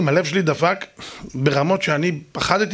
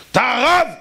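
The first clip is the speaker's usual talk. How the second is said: high, loud indignant challenge; angry shouting neighbour; 6 semitones higher, 3 dB louder than usual. Retold or imitated. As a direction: yell the question like an outraged neighbour. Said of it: "אבל למה אתה צועק". (צעקה של שכן כועס)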